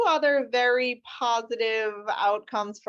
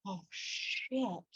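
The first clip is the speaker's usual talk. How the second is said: far softer, as if only for the other attorney; hushed dread; 12 dB quieter than usual. Retold or imitated. Attorney called up to the judge's sidebar, hushed mock dread.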